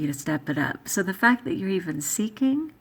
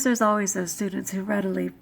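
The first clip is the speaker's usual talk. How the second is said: ordinary talk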